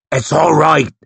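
a hint of a gruff big man's reassurance; lightly growled, comforting a wounded friend. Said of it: "he growled".

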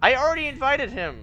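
high, loud exasperated yell; frustrated shout. Real, not acted. Frustrated with friends over invite.

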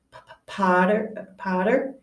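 nervous stutter on every start; a hint of a timid, stammering teacher. A narrator's reading. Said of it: "stammered Professor Quill".

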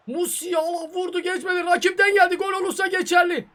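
high, loud excited reaction; yelling at the screen as the shot goes in; excited outburst. Real, not acted. Gamer yelling at his match, excited.

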